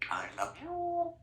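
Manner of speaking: put-on talking to a baby. Very high baby talk.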